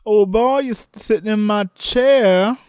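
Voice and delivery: high, hammed-up bossy lawyer voice; mock old lawyer. Joking about Mackenzie's chair, high hammed-up lawyer.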